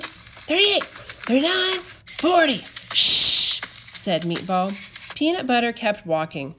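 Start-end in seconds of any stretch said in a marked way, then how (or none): 0.0-2.6 high, quick counting voice; counting under breath. A narrator's reading. Meatball counting jumps. 2.9-3.6 loud unvoiced shush; sharp shush. A narrator's reading. Meatball shushing.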